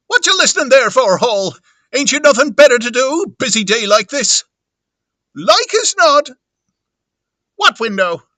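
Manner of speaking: high, loud scolding wife; shrieking reprimand to a husband; Missus Hall. Acted. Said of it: "Missus Hall".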